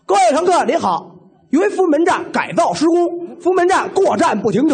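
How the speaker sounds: small loudspeaker announcement. Retold or imitated. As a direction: blare it through a small speaker. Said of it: "小喇叭响了".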